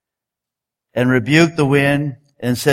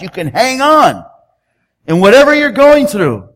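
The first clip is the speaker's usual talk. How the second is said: rising, louder preaching push; fervent preaching; 5 semitones higher, 3 dB louder than usual. Acted. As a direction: lift the congregation, pressing each line higher and louder. Preacher urging listeners to hold on.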